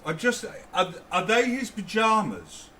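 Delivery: high, startled disbelief; shocked double take; surprised. Real, not acted. Surprise at pajamas.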